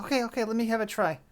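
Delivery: high, quick, eager character voice; excited volunteer jumping in. Acted. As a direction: rush it out, eager for a turn. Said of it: "Fran gets excited".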